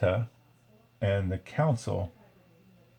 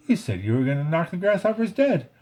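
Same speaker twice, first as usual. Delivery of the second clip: higher, indignant accusation; accusing; 7 semitones higher than usual. A narrator's reading. Flick accusing the circus bugs of lying. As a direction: throw it back at them, betrayed.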